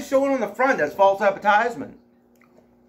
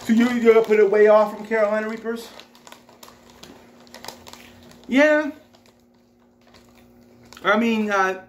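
speaking between chews; talking while chewing. Real, not acted. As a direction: mouth partly full of chip. Seen 3: Left man bit a chip at -2s; jaw and cheeks still working throughout.

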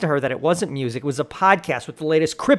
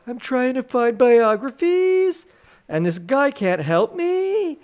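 high, slow put-on girl voice; lunatic girl voice; 9 semitones higher, slower than usual. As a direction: play the whiny customer, pitched high and slow. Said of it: "the lunatic girl was like".

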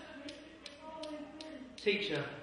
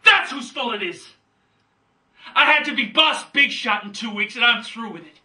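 loud, high outburst at a father; angry confrontation; 8 semitones higher, 6 dB louder than usual. Acted. Son confronting his father Willie in Death of a Salesman, furious shouting. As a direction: hurl it out in a raised, furious voice.

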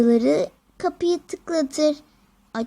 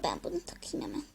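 whispering; 15 dB quieter, faster than usual. Acted. Whispered line.

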